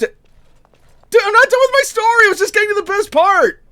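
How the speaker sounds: high and loud protest; insistent call. Acted. Apprentice calling after a departing listener, loud and high. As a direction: call out so they don't leave.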